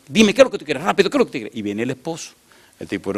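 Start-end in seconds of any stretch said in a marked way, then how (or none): 0.1-1.6 high, insistent mock impatience; impatient demand. Woman presses the stranger for his business, impatient demand. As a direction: imitate her pressing for an answer at once.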